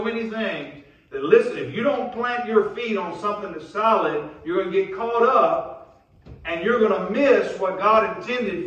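fired-up sermon delivery; impassioned preaching. Real, not acted. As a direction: preach it hard, driving each phrase to the congregation. Preacher at full force.

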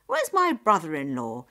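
loud, indignant demand; acted anger, pushed out hard; angry. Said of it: "Nyap said angrily".

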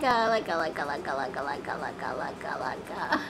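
stuttering loop fading way down; glitching timer. Pretend. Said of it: "this is me with the timer".

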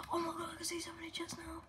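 excited hushed whisper. Real, not acted. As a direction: breathe it out in awe. Whisper in game.

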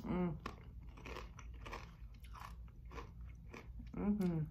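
talking while biting chip; chewing chips. Real, not acted. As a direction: mouth full of chip. Seen 3: Bites and chews a tortilla chip from 3.4 s onward.

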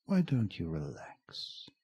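slow menacing whisper; creepy villain whisper. Acted. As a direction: breathe it slowly like a predator. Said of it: "you have a good, creepy, uh, villain voice".

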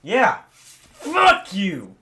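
loud, high taunting yell; triumphant shout. Real, not acted. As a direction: shout it at the game in victory. Gamer taunts the game.

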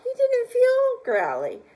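high, loud storytelling; excited narration. A narrator's reading. Reader speeding the tale up for the child, excited. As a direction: build the excitement.

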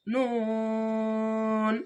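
নুন held extremely long at a steady pitch; tajweed madd demo; very long held vowel. Shown as a demonstration. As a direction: sustain নুন for three counts. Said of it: "তিন আলিফ টেনে পড়বেন".

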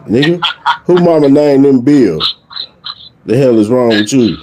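normal talking voice

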